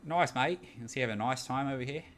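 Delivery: corny put-on Australian accent; casual, hammed-up Australian accent. Shown as a demonstration. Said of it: "So that's my, my accent".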